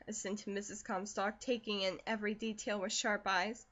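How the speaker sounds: plain reading voice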